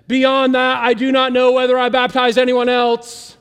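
a hammed-up fed-up apostle; mock exasperated. Paul dictating, fed up with Stephanas, loud. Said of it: "He's like".

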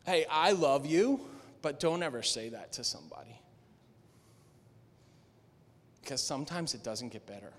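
soft, low, tender aside; quiet and caring after the shout; gentle correction. Real, not acted. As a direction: pull back and speak softly to them. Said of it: "pastoral aside after shout".

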